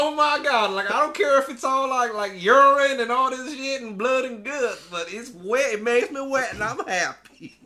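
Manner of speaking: high, loud, gleeful mock voice; silly kid voice. Imitating the soaked kid, hammed-up happy squeal. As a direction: gush like an overexcited kid. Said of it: "Why does he talk like that?".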